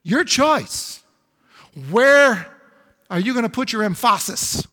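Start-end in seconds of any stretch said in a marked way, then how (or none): 3.1-4.7 "emphasis" said em-FAH-sis; jokingly mis-stressed "emphasis", em-FAH-sis; misplaced syllable stress. Shown as a demonstration. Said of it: "On which syllable are you gonna put your emphasis".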